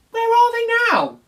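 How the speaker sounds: anxious small bear pleading; Little Bear anxious. Acted. Little Bear asks Sailor where his trousers went, high and anxious.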